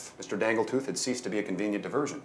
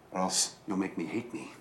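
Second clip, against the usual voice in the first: seethe under control, softer; seething; 7 dB quieter than usual. A narrator's reading. Bag lady threatening Mister Dangletooth, quietly seething. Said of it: "I seethed".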